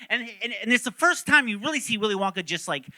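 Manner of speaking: ordinary delivery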